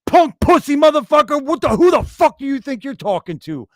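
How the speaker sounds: retold angry yell. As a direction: bark it back in mock rage. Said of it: "I was like".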